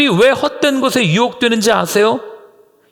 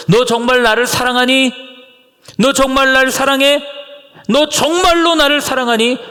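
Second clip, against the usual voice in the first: pleading question; 6 semitones higher than usual. Pretend. Jesus voiced asking Peter thrice.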